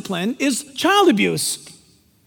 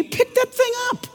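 urgent, much higher command; urgent high call; 12 semitones higher than usual. Real, not acted. Preacher urging the congregation to grab the penny, much higher. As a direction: snap it out high, like a parent. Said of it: "urging to pick up penny".

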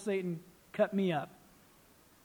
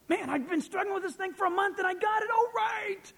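much higher, ending in a shout of victory; triumphant cheer; 12 semitones higher than usual. Retold or imitated. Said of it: "voicing triumphant believer".

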